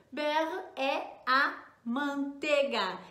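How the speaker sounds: slow teaching delivery. Shown as a demonstration. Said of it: "O que que é beurre?".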